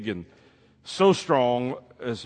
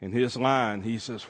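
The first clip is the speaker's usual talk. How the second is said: plain reading voice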